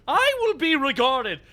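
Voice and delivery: Bluster voice. Retold or imitated. Bluster impression.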